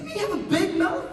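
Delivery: high, loud incredulous question; mock indignation. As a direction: squawk it back as if wrongly accused. Indignant reply to brother.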